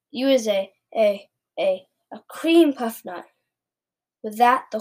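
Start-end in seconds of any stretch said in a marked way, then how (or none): 0.1-3.3 louder giant groping for an insult; spluttering shout. A narrator's reading. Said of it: "shouted the blood bottler".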